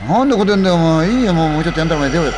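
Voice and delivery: loud, high, fast irritated retort; annoyed protest. Acted. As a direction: snap back loudly, refusing to budge. Drunk friend refuses to leave.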